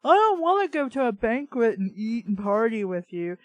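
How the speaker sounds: ordinary delivery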